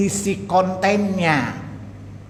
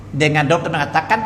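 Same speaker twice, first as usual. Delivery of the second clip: ordinary delivery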